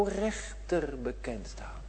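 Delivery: slow hushed whisper. Real, not acted. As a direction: drop to a grave whisper. Whispered aside on judgment.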